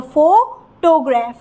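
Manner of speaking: "Photograph" slowly, first syllable "फो" stressed. Shown as a demonstration. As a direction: lean on the opening "फो" of "photograph". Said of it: "हो पे sound पे ज़्यादा stress है". ("Photograph" में "फो" पर ज़ोर, धीरे)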